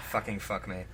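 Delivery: usual speaking voice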